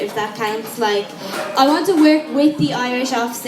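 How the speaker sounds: ordinary talk, nothing marked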